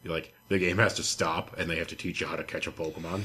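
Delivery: ordinary talk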